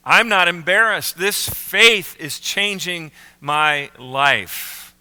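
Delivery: emphatic, deliberate profession of faith; bold declaration. Acted. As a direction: declare it boldly, then space out each word. Acted declaration of newfound faith.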